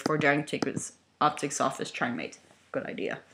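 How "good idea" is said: whispered agreement. Acted. Nervous agreement, unvoiced.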